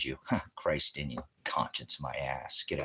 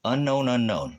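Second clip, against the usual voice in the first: loud, higher announcing call; 7 semitones higher, 6 dB louder than usual. Real, not acted. Calling out an unknown commenter loudly. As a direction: call it out to the room.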